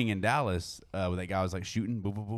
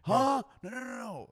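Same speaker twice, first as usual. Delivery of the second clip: high squeaky voice. Retold or imitated. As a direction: squeak out the denials. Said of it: "He's like".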